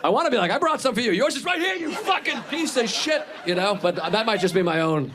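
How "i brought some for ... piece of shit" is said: high-pitched, slowing mock scolding; mock outburst. Comic pretends to tell off someone, mock outburst.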